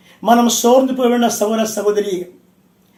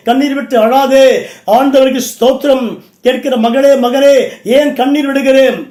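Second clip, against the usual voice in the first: raised, insistent preacher's voice; forceful preaching; 3 semitones higher, 5 dB louder than usual. Real, not acted. Preacher urging weeping brothers and sisters not to fear, forceful preaching. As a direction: exhort them with ringing conviction.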